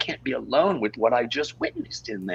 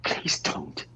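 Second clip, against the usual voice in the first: lower, whispered plea; 12 semitones lower, 6 dB quieter than usual. Acted. Peter begs Marilyn one last time, low whisper.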